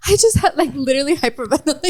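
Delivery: high hyperventilating cry, half laughing; retold breathless blubbering; imitated bawling. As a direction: gasp it out in a mock sob. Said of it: "sees me and I'm bawling".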